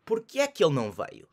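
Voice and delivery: quick and slurred together; native speed. Shown as a demonstration. Teacher repeating the native-speed version, fast. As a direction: say it fast and natural. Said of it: "as genuine and authentic".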